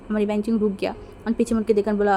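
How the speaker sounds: ordinary delivery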